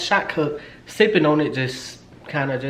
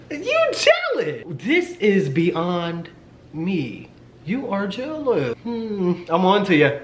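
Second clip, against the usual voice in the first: slow, high-pitched mock accusation; hammed-up gloating taunt; teasing him; 5 semitones higher, much slower than usual. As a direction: draw out every word to needle him. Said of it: "how I'm kinda fucking with him".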